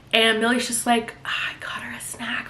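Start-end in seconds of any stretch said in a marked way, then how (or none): 1.2-2.5 tiny whispered excuse; meek whisper. Retold or imitated. Nanny answering her stern boss, meek whisper. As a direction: whisper it like a cowed employee, acted.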